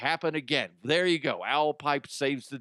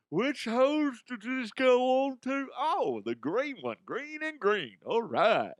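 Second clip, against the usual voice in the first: higher, slow, confused worker voice; mock dim-witted driver; 10 semitones higher than usual. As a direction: puzzle over the hoses like a clueless driver. Imagined tanker driver checking hoses.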